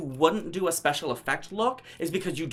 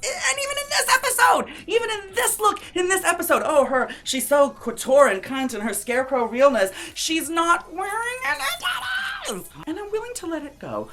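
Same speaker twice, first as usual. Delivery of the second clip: much higher, near crying, building to a shout; shrieking outrage; 11 semitones higher, 3 dB louder than usual. Real, not acted. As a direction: squeal in comic disbelief. Escalating mock-outraged rant.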